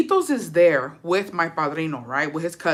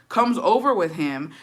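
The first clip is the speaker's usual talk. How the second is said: normal talking voice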